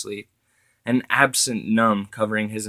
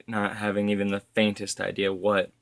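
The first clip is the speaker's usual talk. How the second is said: plain reading voice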